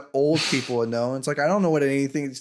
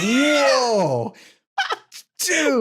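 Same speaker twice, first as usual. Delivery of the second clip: surprised; 8 semitones higher than usual. Retold or imitated. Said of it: "And I was like".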